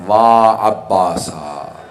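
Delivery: mourning cry. Retold or imitated. Woman calling again and again for Abbas, drawn-out falling lament. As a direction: stretch the name into a falling lament. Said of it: "याद करके रो रही हैं".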